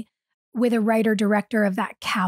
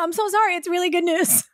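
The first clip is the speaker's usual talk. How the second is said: higher, sheepish apology to the room; high apologetic voice; 7 semitones higher, faster than usual. Retold or imitated. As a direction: say sorry sheepishly, pitched up. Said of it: "and I just went".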